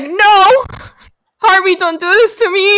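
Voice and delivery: high, loud mock pleading; mock despair. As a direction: retell it as a hammed-up protest. Acting out dismay at pageant mistake.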